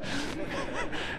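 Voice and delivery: soft breathy chuckle; a brief airy laugh; light laugh. Real, not acted. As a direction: laugh at yourself quietly. Laughs at his own joke.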